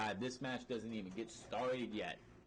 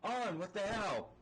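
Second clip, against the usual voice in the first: higher, slow, stunned cry of betrayal; hammed-up shock at a former partner; mock outraged disbelief; 6 semitones higher, slower than usual. Wrestler stunned as his old partner turns, hammed-up disbelief. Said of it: "he's like".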